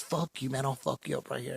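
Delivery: quiet mock menace; hushed threat. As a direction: retell the fighter's threat under the breath. Fighter threatens him again.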